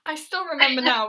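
ordinary delivery

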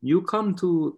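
high, put-on Russian accent. Telling a podcast host about Russian producers, in a Russian accent. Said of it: "I'm doing a Russian accent".

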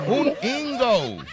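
high "un ingo" with a long "ou" ending; mock English. Shown as a demonstration. Host teaching Eva the answer, exaggerated English accent. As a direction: stretch "ingo" like a gringo. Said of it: "tienes que decir".

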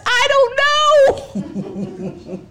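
high, slow, drawn-out laughing panic; squealing laugh. Retold or imitated. Panicking mom laughs high and long over shots. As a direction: stretch it out in a giddy squeal.

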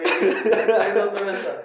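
words squeezed out through real laughter; giggling disbelief; laughing. Real, not acted. Laughing at gross tamal joke.

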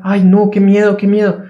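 playful fake terror; mock panic. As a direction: ham up the frightened mind. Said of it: "la voz cuando te dice".

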